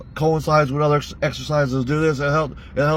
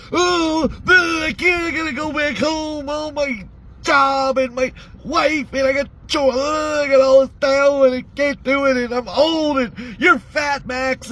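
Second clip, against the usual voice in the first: high, whining mockery of Don's complaints; mocking whiny impression; 9 semitones higher than usual. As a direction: whine it back at him like a sulky child. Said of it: "To listen to you bitch the whole fucking time".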